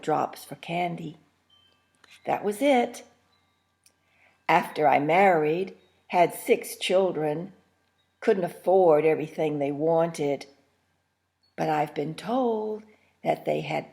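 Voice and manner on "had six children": ordinary delivery, nothing marked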